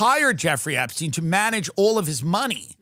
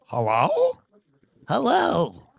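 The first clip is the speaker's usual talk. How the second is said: Epstein voice; much slower than usual. Acted. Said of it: "childlike voice".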